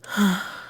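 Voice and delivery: short, audible sigh; resigned huff of breath; exasperated sigh. Acted. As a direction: sigh as if giving in. Gives in to the questioning.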